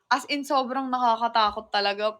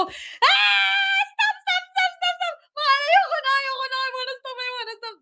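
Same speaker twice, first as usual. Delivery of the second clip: panicked screaming; 14 semitones higher than usual. Retold or imitated. On the Bali swing, begging the pushers to stop, panicked screaming. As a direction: shriek in terror on a swing ride. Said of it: "Sumisigaw talaga ako".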